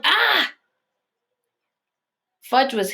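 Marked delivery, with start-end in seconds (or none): 0.0-0.5 sudden high yelp of shock; high startled yelp. A narrator's reading. Peter feeling ice down his back, sharp yelp. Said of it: "I let out a yelp".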